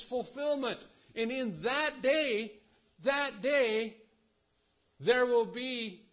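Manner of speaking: high, loud, rising sermon climax; fervent pulpit preaching at top pitch; full-force preaching. Acted. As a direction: preach it out to the whole room.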